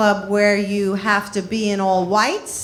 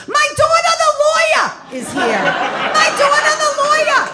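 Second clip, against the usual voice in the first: high, loud screaming mother; 13 semitones higher, 4 dB louder, faster than usual. Retold or imitated. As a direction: yell it proudly like a loud mother. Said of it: "biggest screamer award".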